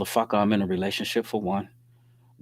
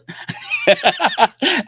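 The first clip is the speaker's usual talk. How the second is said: loud high laugh; 6 dB louder than usual. Real, not acted. Laughing at her insults.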